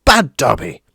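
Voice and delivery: loud self-scolding shout; shouting elf. A narrator's reading. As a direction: punish yourself aloud.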